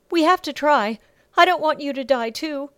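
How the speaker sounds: high, loud, urgent pleading; desperate plea. Acted. Bella urges Sasha through the window, desperate plea. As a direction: plead as if lives depend on it.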